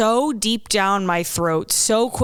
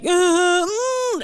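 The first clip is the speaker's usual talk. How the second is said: squeaky hum; 12 semitones higher than usual. Retold or imitated. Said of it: "I'll be like".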